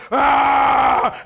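loud mock Hulk roar, shouted; Hulk growl. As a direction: roar it out like a kid playing Hulk. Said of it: "he smashes and he growls".

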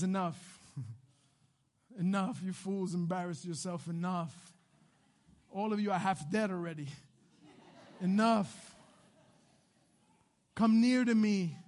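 low, soft "that's enough"; weary dismissal. Acted. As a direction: call it off quietly, with no need to shout. Elijah calls off the failed contest.

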